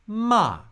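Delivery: ma starts very high and drops sharply; fourth tone. Shown as a demonstration. Teacher gives ma the fourth tone, sharp fall. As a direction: snap ma down from the top.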